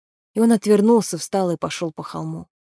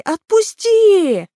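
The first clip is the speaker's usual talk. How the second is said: much higher and louder, slow drawn-out shriek; shrieking creature cry; 13 semitones higher, 8 dB louder, much slower than usual. Acted. Captured creature begs Naal to let go, shrieking. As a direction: stretch the word into a desperate scream. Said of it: "закричал Граги".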